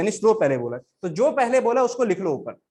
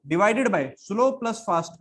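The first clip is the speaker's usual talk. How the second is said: slow formula dictation; much slower than usual. Shown as a demonstration. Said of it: "dictating the formula".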